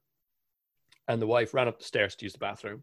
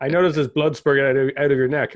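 mock alarmed observer; 5 semitones higher, 9 dB louder than usual.

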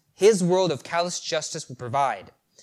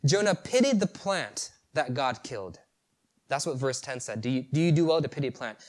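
ordinary delivery

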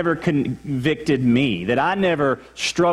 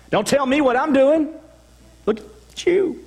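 much higher, defensive snap; indignant mock outburst, pitched way up; angry retort; 11 semitones higher than usual. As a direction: lash back at the person pointing out the sin. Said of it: "I get mad".

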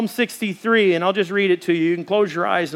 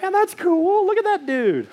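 impressed; 11 semitones higher than usual. Retold or imitated. Guide admiring a man singing with young people, high and impressed. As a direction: pitch jumps high on that's cool. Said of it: "And I'm like".

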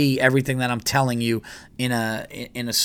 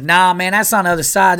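high, loud southern twang; hammed-up southern local woman; mock southern accent; 7 semitones higher, 7 dB louder than usual. Local woman correcting hikers, exaggerated southern accent. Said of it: "Not southern accent".

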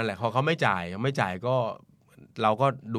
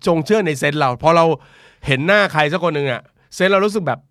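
excited; 4 semitones higher, 7 dB louder than usual. Real, not acted. Excited talk after a laugh.

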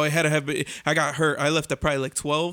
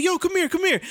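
mock stranger; 12 semitones higher than usual. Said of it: "he's like".

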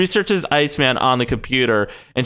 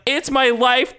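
higher-pitched bratty protest; mock teenage defiance; 9 semitones higher, slower than usual. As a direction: imitate a teen arguing with dad. Acts Bobby as rebellious teen.

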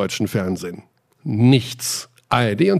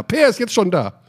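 ordinary talk

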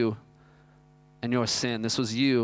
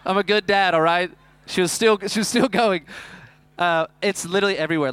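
louder and higher, laughing through it; loud laughing exclaim; 5 semitones higher, 6 dB louder than usual. Real, not acted. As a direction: shout it out while still laughing. Laughing story climax, loud.

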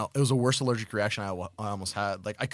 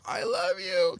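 doting on a cat; 7 semitones higher, slower than usual. Retold or imitated. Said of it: "but I'm still like".

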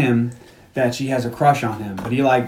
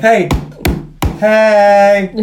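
put-on drunk call; 5 semitones higher, 5 dB louder than usual. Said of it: "he's drinking loudly".

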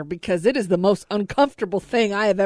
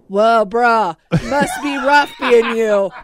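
louder laughing mock bro voice; imitating a bro; 5 dB louder than usual. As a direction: play the chill bro while cracking up. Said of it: "same thing with the Am I the Bad Guy".

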